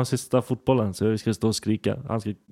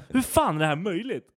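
higher, exasperated disbelief; imitated irritation; 6 semitones higher than usual. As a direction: mimic her annoyed amazement. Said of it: "hon var så irriterad". (härmar irriterad, ljus röst)

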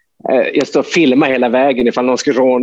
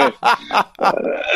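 breathy laugh; 11 semitones higher, 4 dB quieter than usual. Real, not acted. Laugh after panic quote.

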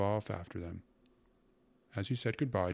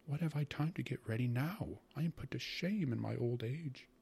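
higher-pitched, distressed mother; numb with horror; 6 semitones higher than usual. A narrator's reading. Mother shamed before unexpected guests, horrified lament. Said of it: "whispered my mother, numb with horror".

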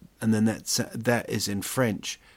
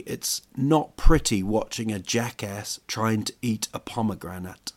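normal talking voice

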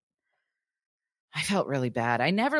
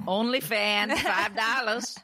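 joke plug; 5 semitones higher, slower than usual. Pretend. Joke as camera falls.

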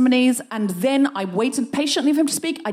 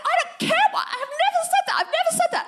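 rapid, squealed denial; high-pitched outrage; much faster than usual. Retold or imitated. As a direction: deny it frantically, far up in pitch. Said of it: "I got a bit high-pitched".

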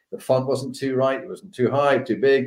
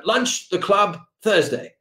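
terse general; 7 semitones higher, slower than usual. Retold or imitated. General's terse invitation on phone.

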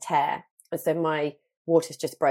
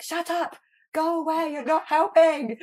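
high, playful, slowing into louder not helping; mock shooing; 11 semitones higher, 3 dB louder, slower than usual. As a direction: shoo them off in a high teasing squeal. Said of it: "And I was just like".

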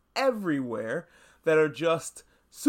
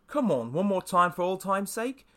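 bad British accent, pitched up; Butcher; 4 semitones higher than usual. Butcher coaxes Huey to team up again, bad British accent. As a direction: imitate him in a higher, hokey British accent. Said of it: "Sorry for my bad British accent".

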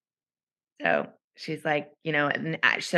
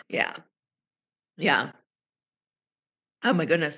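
recovering from choking; strained after choking on a drink; 3 semitones lower than usual. Real, not acted. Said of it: "Sorry, I'm choking to death".

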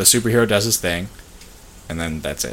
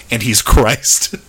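slow, louder deadpan imitation; mock matter-of-fact; 6 dB louder, much slower than usual. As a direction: parrot it slowly as a plain pronouncement. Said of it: "was just so matter of fact. Just like".

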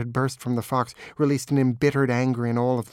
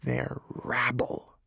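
slow, drawn-out snarl; scornful, dragged-out dismissal; contemptuous snarl; 4 dB quieter, much slower than usual. A narrator's reading. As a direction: snarl it slowly with boundless contempt. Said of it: "snarled the fox, full of a boundless contempt".

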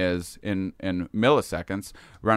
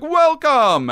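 much higher, louder welcoming voice; entity greeting; 7 dB louder than usual. Retold or imitated. Entity welcoming Shane into the city, much higher. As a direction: greet him high and bright.

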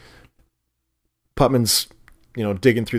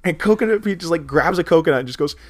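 usual speaking voice

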